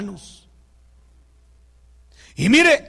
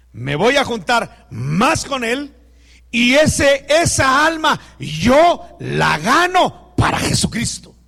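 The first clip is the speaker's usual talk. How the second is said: put-on child's vow, said loud and slow; loud earnest vow; 4 semitones higher, 5 dB louder, slower than usual. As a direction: declare it loudly, like a boy standing firm. Child's defiant reply voiced in story. (voz fuerte y decidida de niño)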